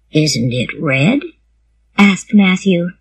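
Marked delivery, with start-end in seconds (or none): 0.1-1.2 low, gentle man's question; quiet man. A narrator's reading. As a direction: ask it in a lower man's voice. Voices Matthew, quiet man.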